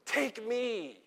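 a drawn cry without added volume. Acted. Elijah offering himself to God, crying out with restraint. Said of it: "he's crying out".

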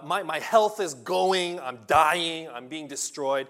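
anguished cry. Acted. Voicing Job's anguished cry.

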